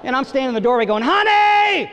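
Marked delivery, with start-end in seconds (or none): 1.0-1.9 excited call. Retold or imitated. Dad in doorway calling wife to film, high excited shout.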